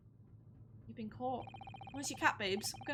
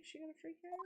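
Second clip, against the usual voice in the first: much softer, worried murmur; muttered under breath; 4 semitones higher, 16 dB quieter, faster than usual. Real, not acted. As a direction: mutter it nervously to yourself.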